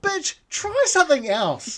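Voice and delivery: high, slow, drawn-out mock exasperation; slowed, high scolding retort; exasperated retort. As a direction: tell her off in disbelief. Exasperated retort to imagined diner.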